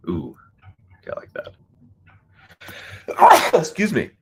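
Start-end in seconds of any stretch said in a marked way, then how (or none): 2.6-3.5 loud sudden sneeze; a real sneeze bursting out; sneezing. Real, not acted. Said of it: "My apologies for the sneezing". 3.5-4.1 breathless right after sneezing; after a sneeze. Real, not acted. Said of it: "My apologies for the sneezing".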